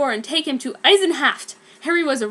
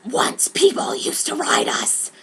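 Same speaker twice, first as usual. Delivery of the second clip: slow villainous whisper; Brom's voice; 3 dB louder than usual. Acted. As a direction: whisper the grievance. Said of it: "said Brom".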